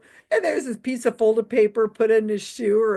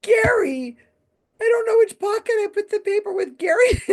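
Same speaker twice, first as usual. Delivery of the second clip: high voice breaking into laughter; imitating flustered magician; 12 semitones higher than usual. Picturing the magician lost among his pockets, high and laughing. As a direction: play him panicking about pockets, laughing. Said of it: "I could see him going".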